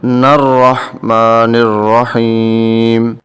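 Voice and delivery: slow, drawn-out tajweed chanting; Quran recitation. Shown as a demonstration. Teacher recites the verse for students, slow chant. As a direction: intone each syllable slowly.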